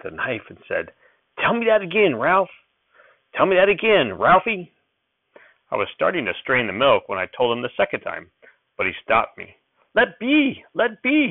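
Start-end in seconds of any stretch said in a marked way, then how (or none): 1.4-4.7 put-on high, loud, urgent old grandpa; excited old-timer demanding to hear it; old prospector. 9.9-11.3 put-on high, loud snapping old man; old prospector snapping. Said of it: "he snapped".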